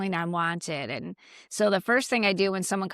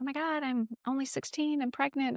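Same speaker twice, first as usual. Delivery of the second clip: higher, softer, a lightly voiced frightened young mother; panicked teen mother; 6 semitones higher, 4 dB quieter than usual. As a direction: imitate a scared sixteen-year-old mom, softly and high. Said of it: "Maybe the mother was like".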